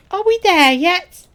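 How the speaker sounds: put-on loud, high, drawn-out kid's nagging; high, slow, loud pestering; impatient kid voice. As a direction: call it out like an impatient child. Voices impatient young game companion.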